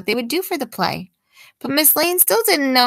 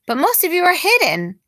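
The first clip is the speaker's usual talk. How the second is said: exclaimed; 6 semitones higher than usual. A narrator's reading. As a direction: exclaim it in dismay. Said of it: "she exclaimed".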